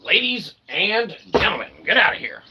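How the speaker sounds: usual speaking voice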